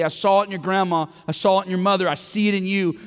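Paul urging Timothy, preached loud and high; passionate preaching. Retold or imitated. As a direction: call it out to the room like Paul urging his son. Paul encouraging Timothy, preached retelling.

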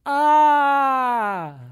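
put-on a loud high wailing cry, like a sobbing child; imitated sobbing wail. Preacher imitates a child who can't stop crying, loud wail. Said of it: "seen a kid cry when the child is crying so hard".

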